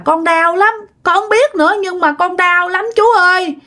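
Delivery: acted sobbing plea, pitched well up; imitated tearful whimpering. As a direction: plead in pain, as if crying to the doctor. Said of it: "cô miếu máu, cô khóc rằng".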